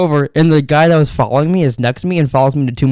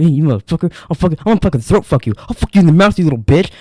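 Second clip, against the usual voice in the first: half-shouted threats, fast and higher; imitating an angry gym guy, fast and higher; retold angry yelling; 4 semitones higher, faster than usual. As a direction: rant at him like a furious stranger. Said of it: "was all pissed".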